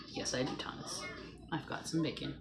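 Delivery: soft breathy whisper; whispered reply. Pretend. Answering viewer Thomas about bacon in a soft whisper. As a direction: confide it like a secret.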